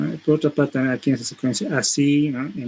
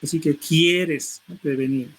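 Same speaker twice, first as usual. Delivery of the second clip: "quieres" slow and stressed, falling; emphasis on quieres; slower than usual. Shown as a demonstration. Teacher imitating the speaker's emphasis on "quieres". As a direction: lean into "quieres". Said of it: "Hace énfasis en esta parte".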